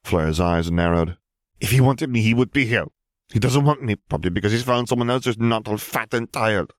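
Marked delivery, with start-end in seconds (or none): 1.6-6.7 high-pitched, wounded complaint; hurt and cross, pitched high; upset outburst. A narrator's reading. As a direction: protest bitterly, voice pushed up. Fleur's upset outburst in quarrel.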